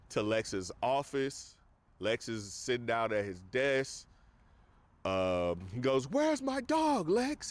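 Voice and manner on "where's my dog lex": much higher, slower angry demand; mock furious Superman; pissed-off demand. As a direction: demand the dog back, voice jumping way up. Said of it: "He's pissed off".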